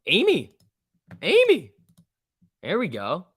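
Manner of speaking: high, loud cheer for a rally; excited cheering. Real, not acted. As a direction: call out the name in excitement. Cheering a stock move.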